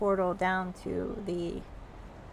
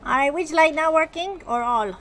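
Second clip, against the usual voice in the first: gnome mechanic; 7 semitones higher, 8 dB louder than usual. Acted. Gnome mechanic asks player.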